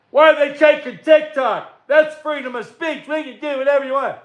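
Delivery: high, whiny mock voice of complainers; mocking whiner. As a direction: parody them as crybabies.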